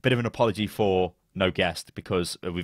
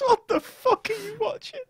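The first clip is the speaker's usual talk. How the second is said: very high, squeaky laughing question; incredulous laughter. Real, not acted. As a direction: ask it while cracking up in disbelief. Incredulous laughter at friend's TV habits.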